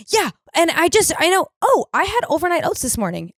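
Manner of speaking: high, startled blurt; sudden realization. Retold or imitated. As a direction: catch yourself mid-sentence. Catching herself mid-sentence.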